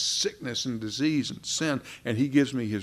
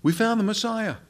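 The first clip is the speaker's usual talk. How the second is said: much higher, thrilled announcement; excited news; 10 semitones higher than usual. Retold or imitated. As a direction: burst out with the good news. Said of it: "What did they say to Nathanael?".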